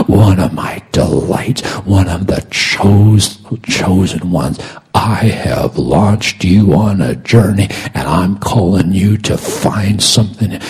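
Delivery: strong, rolling pulpit cadence; fervent preaching. Real, not acted. Preacher voices God's assurance to those in life's storm, fervent preaching. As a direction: proclaim it with swelling volume and emphatic stresses.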